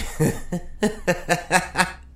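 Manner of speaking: evil laugh. Acted. Villain laughs at himself.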